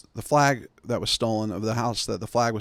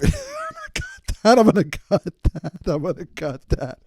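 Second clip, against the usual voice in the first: high laughing squeaky voice, fading down; mock editor voice fading back to normal; silly repeated voice; 6 semitones higher than usual. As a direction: play with the line in a goofy voice.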